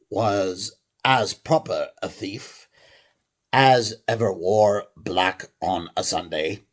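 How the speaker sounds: put-on amateur English accent, slow. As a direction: read it like a stage Englishman. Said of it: "a normal English accent".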